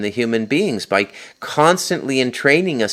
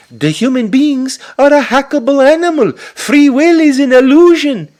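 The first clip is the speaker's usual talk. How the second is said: much higher, louder mocking Israeli accent; mocking Harari's Israeli accent; 7 dB louder than usual. Angry podcaster mocks a hated futurist, in a much higher, louder mock Israeli accent. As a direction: sneer it in a high, loud put-on Israeli accent. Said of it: "In his Israeli accent, his pig Yiddish accent".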